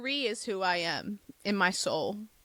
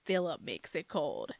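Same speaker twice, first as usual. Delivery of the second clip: ordinary talk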